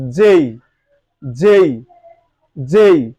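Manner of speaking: "যেই" with a full gliding ey ending; three separate, emphatic takes of "যেই"; correct pronunciation. Shown as a demonstration. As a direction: drill "যেই" as a long falling "jey". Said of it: "এটার সঠিক উচ্চারণ হচ্ছে".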